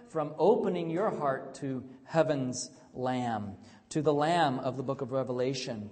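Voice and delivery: rousing pulpit delivery; full-force preaching. Acted. Preaching the sermon.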